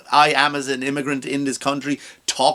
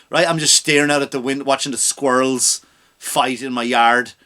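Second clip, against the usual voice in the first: usual speaking voice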